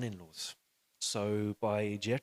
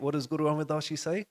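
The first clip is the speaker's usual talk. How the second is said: normal talking voice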